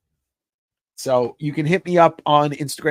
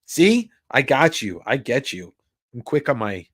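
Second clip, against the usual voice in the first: ordinary talk, nothing marked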